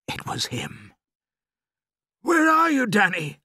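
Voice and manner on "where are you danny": high, loud searching call. A narrator's reading. Father calling to his son in the dark, calling out. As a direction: call out to find your son. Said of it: "my father called out".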